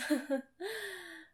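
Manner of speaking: short high laugh; a quick breathy laugh. Real, not acted. Laughs after intense moment.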